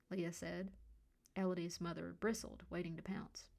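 plain reading voice

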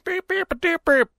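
high babbled nonsense nagging; mocking her accent. Man mimics woman ranting at his window, high mock babble. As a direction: imitate her rant as high-pitched gibberish. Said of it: "that fucking accent up towards my window".

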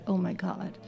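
ordinary delivery

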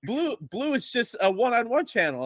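high, baffled exclamation; incredulous Peter. Retold or imitated. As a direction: act out confused disbelief.

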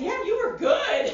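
high, slow amazed friend; drawn out with amazement; slow impressed high voice. Retold or imitated. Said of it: "I remember Lyra was like".

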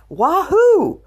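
high, loud wahoo; gleeful, ringing cheer; exuberant cheer. Real, not acted. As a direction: whoop it out in celebration. Celebrating the fun date.

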